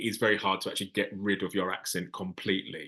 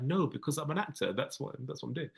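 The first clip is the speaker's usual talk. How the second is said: higher, softer retort, fading away; retold protest, pitched up and trailing quieter; mock exasperated reply; 5 semitones higher, 6 dB quieter than usual. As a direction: imitate explaining the obvious, quietly. Acting out own reply to them.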